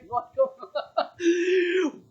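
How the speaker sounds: long, high-pitched laughter; drawn-out high cackle; high squealing laugh. Real, not acted. Laughs at the poor show of hands.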